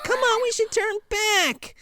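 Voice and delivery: very high pleading whine; mock frightened boy. Boy urging the girl back, high mock pleading. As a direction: beg in a hammed-up falsetto. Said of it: "He's like".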